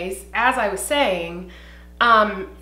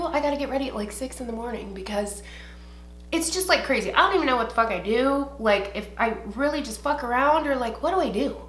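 ordinary talk, nothing marked

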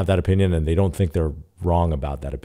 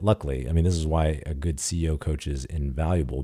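ordinary talk, nothing marked

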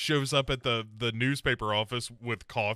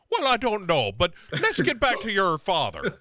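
higher, louder mock dodge; mock Sarah; 6 semitones higher, 4 dB louder than usual. Friend mocking Sarah dodging the question, high. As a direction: brush it off and steer back, high. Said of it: "she's like".

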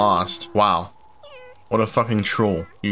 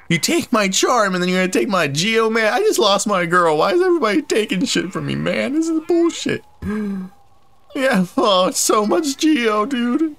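high indignant whine; 11 semitones higher than usual. Real, not acted. Robbed again, complaining.

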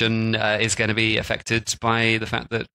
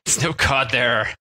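slow, hammed-up pirate accent; slower than usual. Host jokes that the cod is gone, in a slow pirate accent. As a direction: drag it out like a grumbling old sea dog with a pirate accent. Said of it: "doing a strange pirate accent for a Portuguese sailor".

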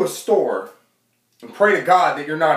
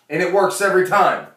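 usual speaking voice

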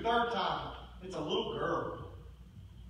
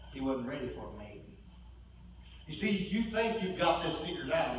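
ordinary talk

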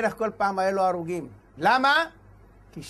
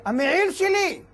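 high-pitched mock yell; pitched-up imitation of shouting, not full volume; retold shout; 8 semitones higher, slower than usual. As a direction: imitate a son crying out his claim. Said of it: "הבן צועק".

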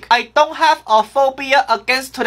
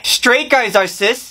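ordinary delivery

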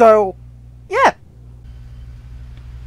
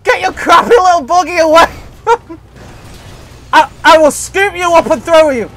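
exasperated threat; 5 semitones higher than usual. Real, not acted. Player warding off Starlight's buggy, exasperated threat. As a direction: warn them off, worked up.

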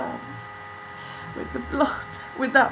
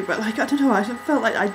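ordinary talk, nothing marked

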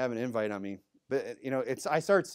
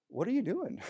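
gentle, friendly question, pitched higher; nice way; 8 semitones higher, 3 dB quieter than usual. Retold or imitated. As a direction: ask kindly, no accusation. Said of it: "In a nice way".

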